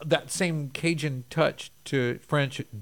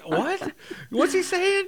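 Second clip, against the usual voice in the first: much higher, louder baffled "What? What's he saying?"; baffled Frenchmen; 6 dB louder, slower than usual. Retold or imitated. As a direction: mimic a bewildered listener. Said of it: "And they're like".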